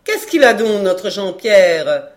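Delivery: loud drawl, slowing on the name. Acted. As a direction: drag out the astonished question. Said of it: "fit-elle de sa voix traînarde". (voix traînarde et sonore)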